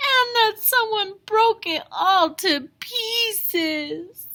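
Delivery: high, loud, slow crying cub; Baby Bear crying. A narrator's reading. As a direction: wail it like a heartbroken little bear. Baby Bear sees broken chair.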